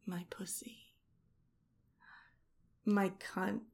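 very soft, teasing reveal; soft and confiding; hushed reveal. Acted. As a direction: drop it almost to a whisper. Teasing reveal after a pause.

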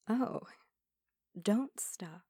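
ordinary delivery

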